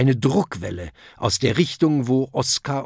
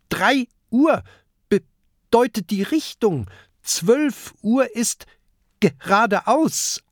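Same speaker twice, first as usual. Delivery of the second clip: high, halting voice, some words stretched slow; patient little know-it-all with a stammer; stuttering Peter explaining; 11 semitones higher, 3 dB louder, slower than usual. Acted. Peter explains sailor talk.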